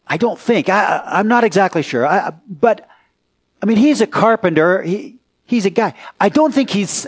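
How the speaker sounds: ordinary delivery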